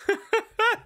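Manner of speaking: high-pitched burst of laughter; appalled laugh. Real, not acted. Streamer laughing at something terrible, appalled laugh. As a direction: crack up high in horrified amusement.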